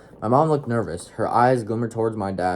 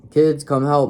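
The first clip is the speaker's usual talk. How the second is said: loud call from outside; dad calling kids in from outside; yelled; 6 semitones higher, 7 dB louder, slower than usual. Acted. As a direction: shout it from outside the house. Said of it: "my dad yelled from outside".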